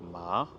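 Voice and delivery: MA with rising pitch; ma sliding upward; second tone. Shown as a demonstration. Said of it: "c'est le ton qui remonte".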